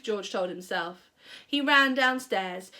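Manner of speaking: ordinary delivery, nothing marked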